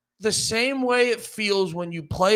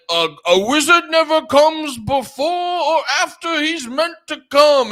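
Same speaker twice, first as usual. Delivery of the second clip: mock wizard; 7 semitones higher, 4 dB louder than usual. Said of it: "And he's like".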